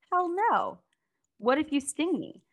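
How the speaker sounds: a startled frog balking at the idea; alarmed frog. Retold or imitated. Frog voiced in retold fable.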